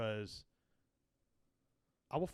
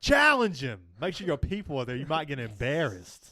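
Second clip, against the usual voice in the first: mock challenge; 8 dB louder than usual. Daring a coworker to a fight, loud mock bravado. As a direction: hype it like calling someone out.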